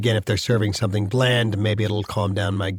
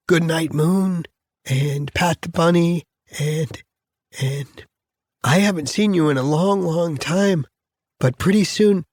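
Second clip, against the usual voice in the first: higher gentle voice, faltering between phrases; tender daddy voice; 6 semitones higher than usual. Acted. Father faltering on phone to his daughter, high tender voice. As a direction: search tenderly for words for a child. Dad falters speaking to child.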